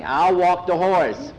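the sidekick reluctantly agrees to walk the horse, slow and whiny; reluctant boy. Acted. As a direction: drag it out like a sulky boy giving in. Skeeter grudgingly agrees again.

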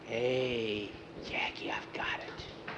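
slow, breathless secret whisper. Acted. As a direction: hush it like sharing a secret in bed. Secret discovery told at night.

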